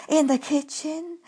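ordinary delivery, nothing marked

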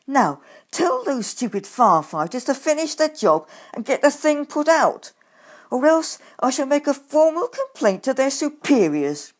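loud indignant demand; angry. A narrator's reading. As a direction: threaten loudly, furious. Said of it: "I snapped".

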